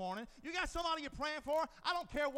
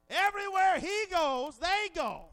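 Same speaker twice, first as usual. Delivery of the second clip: louder, higher repeat; emphatic preaching shout; 6 semitones higher, 10 dB louder than usual. Real, not acted. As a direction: hit it harder the second time. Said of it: "repeated louder".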